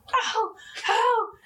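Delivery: mock wincing. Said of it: "she was like".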